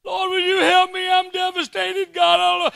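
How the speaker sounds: high, loud, pleading sinner's wail; mock desperate sinner. Preacher playing a desperate sinner begging God, high wail. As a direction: beg God in a hammed-up panic. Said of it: "And you know what God says?".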